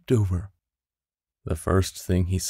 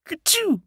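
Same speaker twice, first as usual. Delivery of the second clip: a sudden very high squeaky sneeze; little rabbit sneezing in hiding. A narrator's reading. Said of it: "Peter sneezed".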